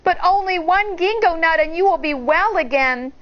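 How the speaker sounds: high, lightly voiced girl feigning regret; mock apologetic, coaxing; child's voice. A narrator's reading. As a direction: Shang fakes regret to the wolf, a high child voice. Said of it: "Shang said".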